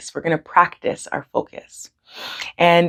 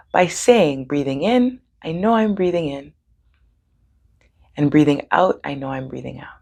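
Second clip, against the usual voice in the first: calm breathing guidance with long pauses; guided meditation. Real, not acted. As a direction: lead them gently, leaving room for each breath.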